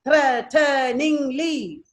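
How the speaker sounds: THREATENINGLY very slowly, four separate beats; THREATENINGLY pulled apart as threa, te, ning, ly; syllable by syllable. Shown as a demonstration. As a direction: count out THREATENINGLY by its sounds. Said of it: "four syllables".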